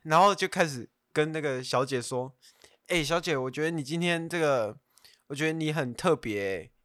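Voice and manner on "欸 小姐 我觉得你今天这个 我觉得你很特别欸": ordinary talk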